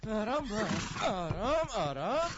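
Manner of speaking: high, loud urgent calls; urgent shouting. Acted. Holding the horse and ordering his friend to hurry, shouting.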